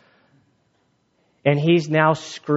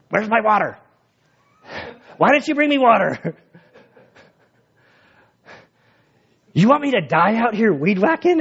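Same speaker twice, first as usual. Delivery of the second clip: higher, indignant whining complaint, playing his own tantrum; mock angry outburst; 7 semitones higher than usual. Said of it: "and I get all mad".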